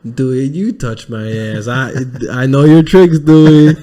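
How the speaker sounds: slow imitation building to a loud finish; movie character. Host re-enacts the movie line, slow mock accusation building loud. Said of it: "He's like".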